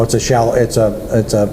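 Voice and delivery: usual speaking voice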